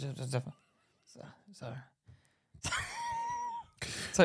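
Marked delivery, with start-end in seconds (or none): 2.6-4.1 high, quiet wheezing laugh; real laughing fit; helpless laugh. Real, not acted. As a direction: crack up mid-story. Breaks up laughing mid-story.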